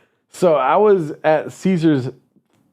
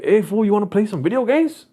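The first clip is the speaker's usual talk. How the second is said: higher-pitched mock homeboy invitation; Cesar; 7 semitones higher than usual. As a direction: put on a cousin's high, casual voice. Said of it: "Cesar goes like".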